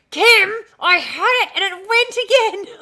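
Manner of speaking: high, loud laughing complaint; frustrated laughing exclaim. Real, not acted. To Kim about a lost memory, high laughing wail.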